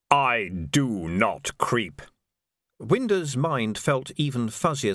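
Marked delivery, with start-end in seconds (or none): 0.1-2.0 a slow, deep, unhurried reaper's voice; each word dropped like a stone; Death, slow and hollow. Acted. Death's held voice.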